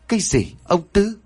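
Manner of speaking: high, stunned exclamation; husband hearing the dead neighbour's name, shocked; shocked outburst. Acted. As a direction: blurt the name in disbelief. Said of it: "muốn thốt lên".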